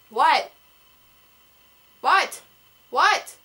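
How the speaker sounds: loud call to someone in another room. Real, not acted. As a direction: shout back a puzzled what. Called from offscreen mid-explanation.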